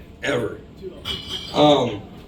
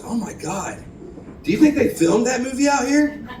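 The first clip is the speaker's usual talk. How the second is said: put-on high ditzy girlfriend voice; mock the girlfriend's airheaded question; 8 semitones higher than usual. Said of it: "and she goes".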